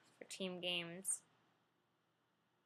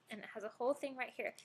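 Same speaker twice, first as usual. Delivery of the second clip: ordinary delivery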